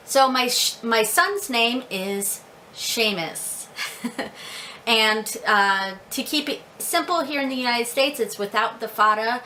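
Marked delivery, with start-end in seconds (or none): none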